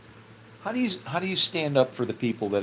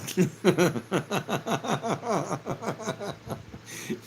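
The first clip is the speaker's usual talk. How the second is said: long, higher chuckling laugh; 6 semitones higher than usual. Real, not acted. Speaker laughing at his own retirement joke, long laugh. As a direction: laugh it off, wheezing.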